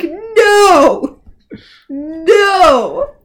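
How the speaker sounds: loud, high, drawn-out no; hammed-up appalled cry; alarmed mock shout. Podcaster plays Regina stopping a father arming his son, appalled. Acted alarmed scolding at armed child.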